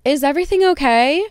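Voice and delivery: asking a stranger if things are fine; concerned. Retold or imitated. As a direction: gently check on a stranger. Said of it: "part of me wanted to be like".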